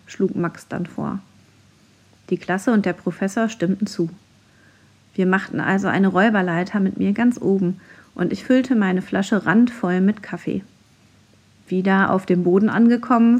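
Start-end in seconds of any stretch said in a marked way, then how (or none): none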